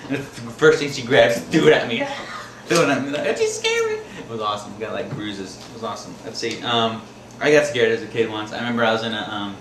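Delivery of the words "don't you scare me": very high-pitched wife impression; mock scolding squeal. Wife throws things at her prankster husband, high mock scolding.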